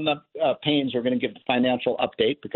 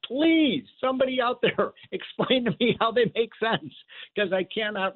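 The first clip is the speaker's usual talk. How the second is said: high-pitched, pleading exasperation with a laugh; exasperated plea; 9 semitones higher than usual. Real, not acted. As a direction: beg someone to explain, at wits' end. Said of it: "You can tell I'm frustrated".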